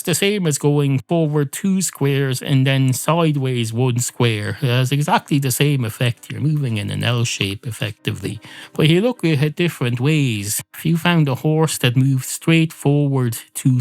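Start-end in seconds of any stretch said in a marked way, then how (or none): none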